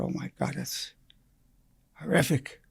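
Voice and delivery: breathless, hushed horror, almost no voice; whispered shock. Retold or imitated. Doctor hearing her son was shot, whispered shock. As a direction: let the news knock the air out of you.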